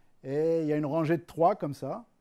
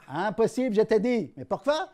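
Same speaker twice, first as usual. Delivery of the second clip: louder, higher back-and-forth; mock argument, raised voices; 6 semitones higher, 7 dB louder than usual. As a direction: push the refusal hard. Heated exchange in joke.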